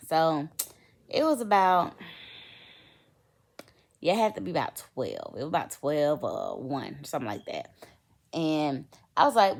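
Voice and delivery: ordinary talk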